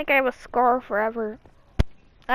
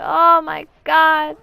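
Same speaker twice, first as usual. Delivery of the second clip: loud, drawn-out "oh my god"; shocked exclamation; 3 semitones higher, 11 dB louder, much slower than usual. Retold or imitated. Child retelling finding blood after hitting their head, loud shocked exclamation. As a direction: exclaim it slowly and loudly in shock.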